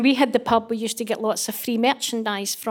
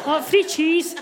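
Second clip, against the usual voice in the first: higher, louder delighted mock man; greedy man; 6 semitones higher, 4 dB louder, slower than usual. Men at the supermarket eyeing free cheese, delighted. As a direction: spot the free cheese with glee.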